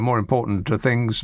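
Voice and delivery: ordinary delivery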